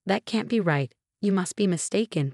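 ordinary delivery

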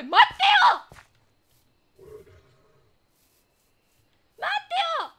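high, loud yell of a name; calling out. Real, not acted. Streamer yelling to Mateo in the game, high loud call. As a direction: holler for someone urgently.

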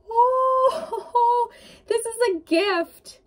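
high, overcome gasp into a teary laugh; moved almost to tears; getting emotional. Real, not acted. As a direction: voice lifting with real emotion. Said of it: "I'm getting emotional for you".

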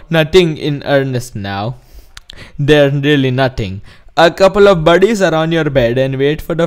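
put-on Indian accent reading; mock Indian-accented script reading. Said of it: "script of it in an Indian accent".

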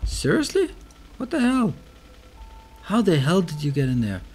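high, loud startled outburst; alarmed disbelief. Real, not acted. Player finding aliens in there, alarmed and incredulous. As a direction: cry out in real alarm and disbelief. Aliens suddenly turn up inside.

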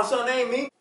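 normal talking voice

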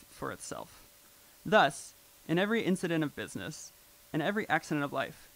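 put-on old-fashioned recitation; reading aloud. Shown as a demonstration. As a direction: read it out like a formal old text. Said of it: "well, I'll just read it".

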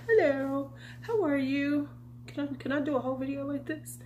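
high, breathy glamorous greeting; lightly camp diva hello; campy drag queen. Pretend. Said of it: "That would be so funny though".

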